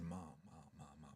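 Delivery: very soft, low repeated plea; hushed calming appeal. Retold or imitated. Son holds mom back from chasing driver, hushed. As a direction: gently talk her down under your breath.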